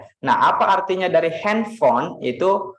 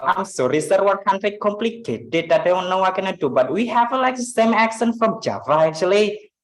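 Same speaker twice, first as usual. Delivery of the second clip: higher-pitched mock Indian accent; 5 semitones higher than usual. Teacher demonstrating for students how it sounds, Indian accent. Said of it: "sounds like Indian accent".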